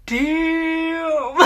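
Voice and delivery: high, loud damn breaking into laughter; laughing shock. Acted. Storyteller reacting to her positive test, laughing disbelief. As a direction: blurt it out and crack up.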